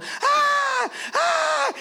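chillidos agudísimos imitando al grupo; gritos de pánico. Retold or imitated. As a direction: imitar a gente que sale gritando. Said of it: "un grupo de personas gritando". (gritos agudos de susto fingido)

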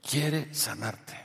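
very slow, soft "Quiere sanarte"; slow emphasis. Real, not acted. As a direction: draw out "Quiere", then let "sanarte" land softly. Repeats promise slowly for emphasis.

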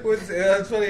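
usual speaking voice

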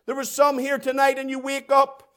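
ordinary delivery

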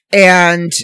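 ordinary delivery

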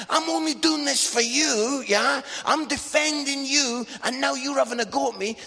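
high, loud retold protest; indignant retort. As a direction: act out a dad raising his voice in his own defence. Acted family quarrel.

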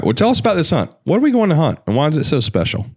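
a lightly upbeat presenter read; host intro. Acted. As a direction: pitch it up like a show host. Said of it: "host voice for camera".

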